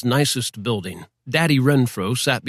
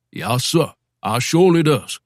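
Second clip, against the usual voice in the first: talking-to-the-man voice; 2 semitones higher than usual. Acted. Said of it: "talking-to-the-man voice".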